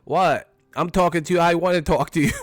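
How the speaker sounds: imitating Mike. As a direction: push back hard as Mike. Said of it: "I use that".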